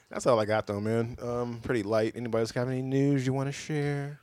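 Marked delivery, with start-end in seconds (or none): none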